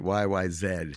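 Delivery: Y Y Z with Z said "zed"; Canadian zed. Shown as a demonstration. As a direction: end Y Y Z on "zed", rhyming with bed. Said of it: "by the way, they pronounce it".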